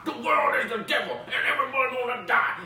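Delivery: high, loud doomsday rant; hammed-up crazed yelling; mock screaming. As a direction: scream it like a raving street prophet. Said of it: "they're literally screaming and swearing".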